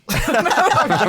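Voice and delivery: loud, high burst of laughter; big real laugh. Real, not acted. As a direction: crack up helplessly. Host cracks up at the voice.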